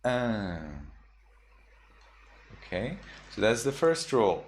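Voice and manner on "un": un hummed through the nose; nasal un ending. Shown as a demonstration. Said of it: "you read it as".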